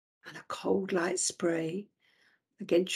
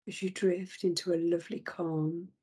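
usual speaking voice

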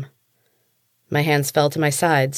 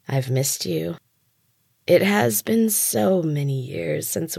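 purring; slower than usual. A narrator's reading. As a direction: croon it with hidden malice. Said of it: "she purred".

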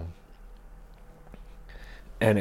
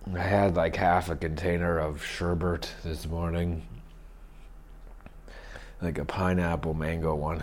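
ordinary talk

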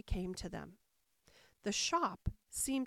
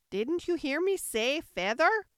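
high, loud indignant Sheep; irritated, raised character voice; angry cry; 6 semitones higher, 7 dB louder than usual. A narrator's reading. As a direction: scold crossly at raised pitch. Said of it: "the sheep cried angrily".

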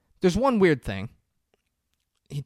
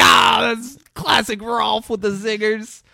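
very high, loud mock hilarity; uproarious group reaction; 12 semitones higher, 9 dB louder, slower than usual. Everyone reacts to Rolf's zinger, uproarious. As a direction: imitate a whole table cracking up.

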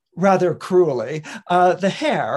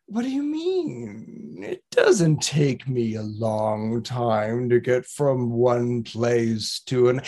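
slow, dragged-out tortoise voice; 7 semitones lower, slower than usual. Acted. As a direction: drawl every word like an old tortoise. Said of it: "the tortoise responds with".

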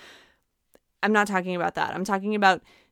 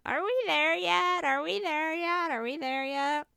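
put-on high whiny kid in the back seat; pestering child; 9 semitones higher, 4 dB quieter than usual. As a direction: nag like an impatient little kid. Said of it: "a little screaming kid in the back seat".

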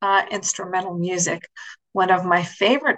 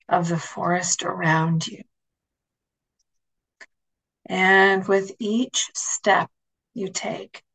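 guided meditation. Acted. Leads a guided imagery meditation.

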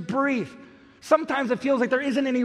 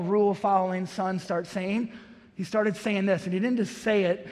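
usual speaking voice

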